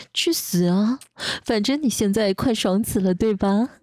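usual speaking voice

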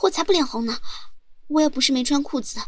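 usual speaking voice

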